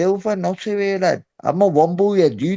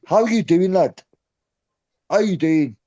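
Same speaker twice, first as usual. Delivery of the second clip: ordinary delivery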